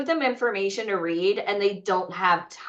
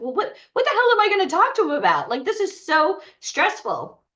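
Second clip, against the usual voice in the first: much higher, fast, then slowing; stressed panic; 9 semitones higher than usual. Retold or imitated. Panicking to Michael about what to say, high and fast. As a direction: rush it out in mock panic. Panicked retold inner quote.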